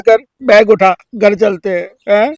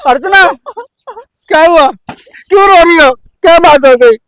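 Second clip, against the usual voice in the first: worried loud calling; 5 semitones higher, 6 dB louder than usual. Acted. Husband alarmed, asking crying wife what happened, raised voice. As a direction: call out her name, alarmed and loud.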